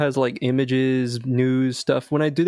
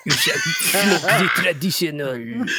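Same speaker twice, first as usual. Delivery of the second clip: higher, slow, hammed-up French accent; mock French proclamation; 5 semitones higher, slower than usual. Podcast friend escalating the French hypnotist parody, higher and slow in fake French. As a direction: announce it grandly in fake French.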